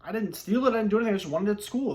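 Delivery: retold panic from a kid who thinks he's in trouble; panicky kid protest. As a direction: imitate your younger self panicking, swearing you did nothing wrong. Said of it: "I started to panic 'cause I thought I was in trouble".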